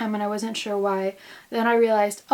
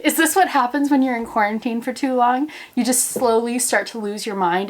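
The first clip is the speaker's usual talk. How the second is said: usual speaking voice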